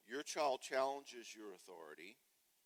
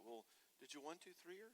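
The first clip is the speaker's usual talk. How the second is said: mild husband question; 5 semitones higher, 10 dB quieter, faster than usual. Retold or imitated. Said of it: "He's like".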